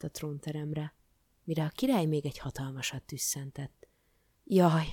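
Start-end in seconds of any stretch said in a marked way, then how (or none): 4.4-4.9 loud, doleful groan; sepulchral lament. A narrator's reading. King realising he is ill, sepulchral lament. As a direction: groan it like doom has come. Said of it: "mondta síri hangon".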